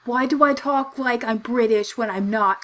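ordinary talk

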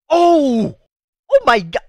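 loud, high cry of astonishment; shocked shout. Real, not acted. Fan watching a big hit land, shocked shouting. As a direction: cry out, stunned by the blow.

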